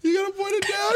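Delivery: high shout through crying laughter; laughing shout. Real, not acted. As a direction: yell it while cracking up. Laughing fit, repeated louder.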